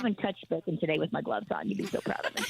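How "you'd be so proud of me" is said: conspiratorial whisper; whispered aside. Real, not acted. Owner confiding to the host in a whisper. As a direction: whisper it like a secret. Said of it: "whispered aside to host".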